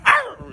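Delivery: high, sharp wildcat snarl; high-pitched mock cougar cry; wild cougar growl. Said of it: "he wants somebody like".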